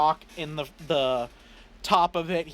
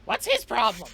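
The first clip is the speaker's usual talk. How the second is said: indignant high sorcerer; high mad-wizard voice; 6 semitones higher than usual. Acted. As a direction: snap like a cranky wizard. Sorcerer character reacts.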